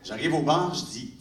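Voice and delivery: normal talking voice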